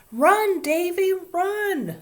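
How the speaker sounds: very high, loud cheering scream. A narrator's reading. Class urging Davy to flee Ramona, screaming. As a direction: scream it like a crowd of kids. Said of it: "screamed the rest of the class".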